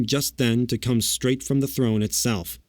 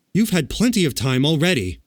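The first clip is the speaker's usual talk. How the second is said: raised, indignant protest; angry; 6 semitones higher, 4 dB louder than usual. A narrator's reading. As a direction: protest angrily at the delay. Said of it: "said the Tin Woodman angrily".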